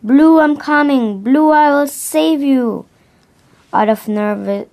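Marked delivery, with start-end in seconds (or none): 0.0-2.9 high, loud rescue call. A narrator's reading. Yellow rushing to save friend Blue, calling out. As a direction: call urgently across the distance.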